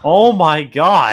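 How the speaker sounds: excited. Real, not acted. Excited reaction to the screen.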